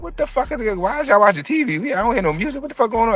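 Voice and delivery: high, baffled yelp of confusion; stunned confusion. Retold or imitated. Student walking into silent classroom, high baffled outburst. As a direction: play a stoned kid who can't believe the room.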